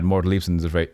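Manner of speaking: ordinary talk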